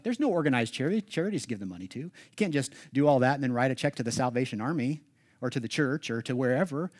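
normal talking voice

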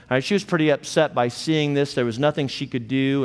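ordinary talk, nothing marked

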